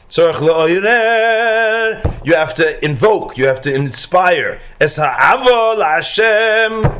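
high, loud chanted text reading; preaching Tanya. Acted. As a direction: intone the holy text with fervor. Reads Tanya aloud in chant.

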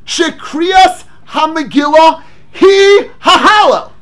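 slow, emphatic, half-chanted recitation; declaiming the Rambam. Acted. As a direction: proclaim the text word by word.

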